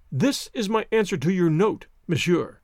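ordinary delivery

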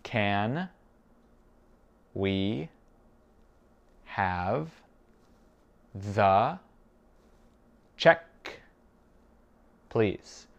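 very slow, long pauses between words; spaced-out language-drill question. Shown as a demonstration. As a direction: isolate each word for a learner. Teacher repeats it word by word.

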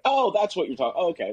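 high-pitched mock dawning realization; sudden realization. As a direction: squeak it out like the penny just dropped. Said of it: "and be like".

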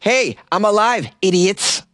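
exasperated shout; annoyed kid. Acted. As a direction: snap at them. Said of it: "add idiots onto the end".

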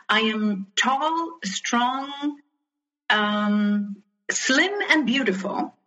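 lightly voiced vain mother; mother's haughty voice. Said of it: "And she said".

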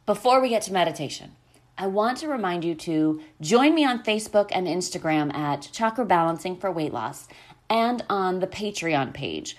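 normal talking voice

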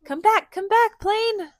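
high, loud call after the plane; teacher calling the toy plane back. Pretend. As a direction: call it home playfully.

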